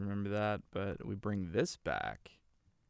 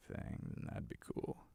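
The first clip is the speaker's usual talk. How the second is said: breathy whispered aside; whispering; 9 dB quieter than usual. Real, not acted. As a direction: whisper it to yourself. Muttered aside while editing.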